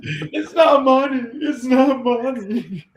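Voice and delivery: high, loud cackling yell of the line; hammed-up laughing shout.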